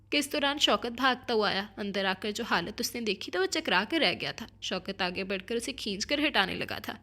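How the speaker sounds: ordinary delivery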